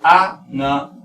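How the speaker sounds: animal in English, split into separate syllables; slow English breakdown of animal with pauses. Shown as a demonstration. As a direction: sound out animal piece by piece. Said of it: "aquí la pronunciación es".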